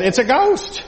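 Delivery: high-pitched cry of alarm; fearful cry. Acted. Frightened disciples seeing a figure on the sea, fearful cry. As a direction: cry it out as if spooked. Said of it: "They cried out in fear".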